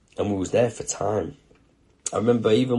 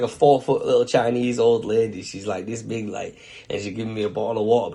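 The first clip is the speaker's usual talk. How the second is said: normal talking voice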